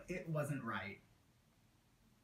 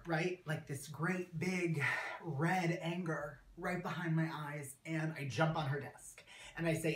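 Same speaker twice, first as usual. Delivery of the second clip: ordinary talk, nothing marked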